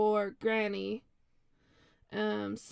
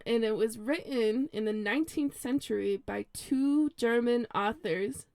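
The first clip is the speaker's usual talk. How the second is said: ordinary talk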